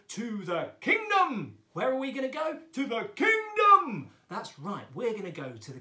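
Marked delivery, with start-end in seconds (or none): 0.1-2.6 mock-grand announcement. Rallying children, call and response. 2.7-4.1 loud, high call with a drawn-out end; rousing call. Pretend. Teacher rallying children to the kingdom, rousing shout.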